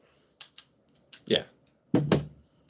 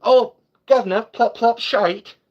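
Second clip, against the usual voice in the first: high, loud mock British accent; cartoonish British accent, pitched way up; imitated British accent; 11 semitones higher, 5 dB louder, slower than usual. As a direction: squawk it in a hammed-up British accent. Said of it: "do you want me to do your accent".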